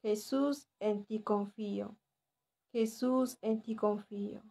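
softly spoken, measured short prayer; repeated prayer. Shown as a demonstration. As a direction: say it gently as a devotion, pausing after the name. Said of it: "tienen que repetir esta oración".

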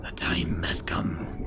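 low, slow ominous whisper; dramatic whisper. Pretend. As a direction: intone it like a trailer. Dramatic build-up before final plan.

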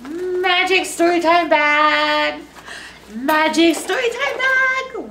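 loud sing-song call to the bag. Pretend. Librarian summoning the story bag, calling out.

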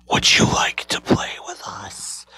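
low raspy ghostly whisper; weird raspy voice. Retold or imitated. Ghostly mother invites him to play, low eerie raspy whisper. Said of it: "In the weirdest, raspiest voice ever".

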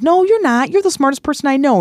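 high, eager reassurance from a friend; reassuring friend. Retold or imitated. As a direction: gush it like a friend cheering you up. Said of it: "someone goes".

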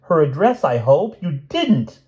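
high, loud frantic wail. A narrator's reading. Francine begs the druggist to deny it, wailing. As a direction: plead in mounting horror, pitch soaring. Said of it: "wailed Francine".

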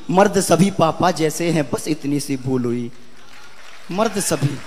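low, soft, sorrowful verse; hushed poem recitation. Acted. Poet voicing the girl's one small mistake, hushed recitation. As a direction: let the line sink away quietly. Poem drops to quiet lament.

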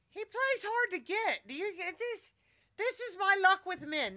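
high, loud mock complaint; playful exasperation. Real, not acted. Mock complaint about pet's rejection.

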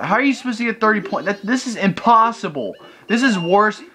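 high-pitched exasperated outburst. Real, not acted. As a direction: rush into a high, incredulous complaint. Raging at impossible game challenge.

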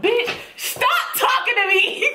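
high, drawn-out mock yell; retold exasperated outburst. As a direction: cut someone off in exasperation. Retold snapping at the sister.

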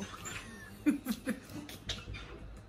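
quiet breathy laughing fit; helpless laughter, mostly without voice; real laugh at a shocking question; stifled laugh. Real, not acted.

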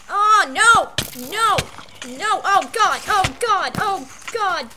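loud, high panicked yelling; real frantic cries of oh no; panicked screams. Real, not acted. Player dying in game, screaming.

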